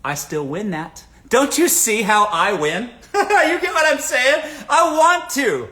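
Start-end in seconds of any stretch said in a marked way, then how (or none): 1.3-5.7 laughing through the words, slow and high; real laugh breaking into the question. Real, not acted. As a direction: crack up while making the point. Laughing at his own point.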